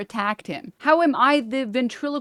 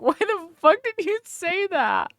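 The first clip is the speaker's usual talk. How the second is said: laughing; 8 semitones higher, slower than usual. Real, not acted. Laughing disbelief at remark.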